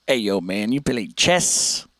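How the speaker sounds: low, slow put-on dude; slow low "yo, man" voice; mock dude voice. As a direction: drawl it low with a rising end. Mock voice replaying chat question.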